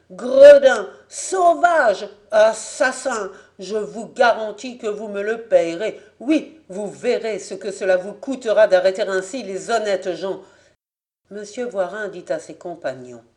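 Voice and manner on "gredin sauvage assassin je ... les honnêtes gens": loud furious tirade; raging. Acted. Raging prisoner threatening his jailers, loud angry tirade. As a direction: shout every insult, then threaten.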